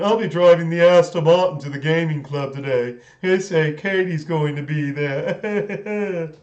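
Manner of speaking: put-on smug posh British accent; Soft Bob posh British. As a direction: boast like a snooty rich Englishman in a British accent. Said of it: "the Soft Bob character".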